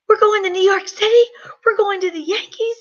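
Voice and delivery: put-on a boy overjoyed at the news; excited shout. Son reading the trip card, high and thrilled. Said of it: "He's like".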